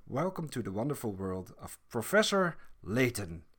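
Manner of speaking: ordinary talk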